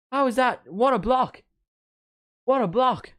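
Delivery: high, loud commentator disbelief; amazed shouting. Real, not acted. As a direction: cry out at an incredible block. Amazed at a blocked shot.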